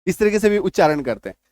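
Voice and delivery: normal talking voice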